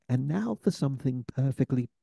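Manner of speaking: hint of a refined British announcer; proper British accent. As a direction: mimic a polished UK friend reading the show intro. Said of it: "in this very proper accent".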